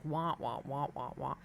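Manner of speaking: low, soft muffled wah sounds; imitating a muffled voice through the wall. Shown as a demonstration. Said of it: "it just sounds like this".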